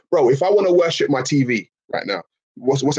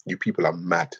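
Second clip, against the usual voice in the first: usual speaking voice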